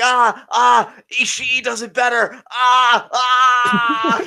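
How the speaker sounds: loud, high pained screams; screaming in pain. Acted. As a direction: yell it out like you're trapped in a hold. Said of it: "in between the screams, I'd be like".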